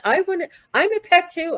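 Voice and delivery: squeaky high little-pet voice; playful cute whine to be loved too; pet dragon pleading. Retold or imitated. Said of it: "and he's like".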